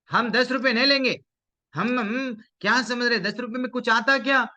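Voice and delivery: indignant refusal. Acted. Children turning down the old man's ten rupees, indignant. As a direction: refuse hotly, pitched well up.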